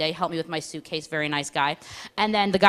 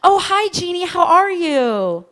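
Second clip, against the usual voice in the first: much higher, louder, drawn-out sweet greeting; over-the-top friendly phone greeting; gushing American girl; 10 semitones higher, 6 dB louder, slower than usual. Retold or imitated. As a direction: coo into the phone like a chatty friend. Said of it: "it goes like this".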